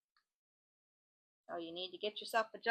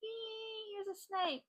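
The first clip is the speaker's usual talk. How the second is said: mock squeal; 10 semitones higher, 6 dB quieter, slower than usual. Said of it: "but you can't do the".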